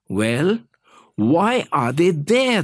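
a touch of panic in the question; frantic. A narrator's reading. Boss questioning the child, mildly frantic. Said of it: "the boss frantically questioned".

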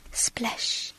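soft whispered sound word; quiet, airy exclamation; hushed splash. A narrator's reading. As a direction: whisper it like water far below. Bucket hits water far below.

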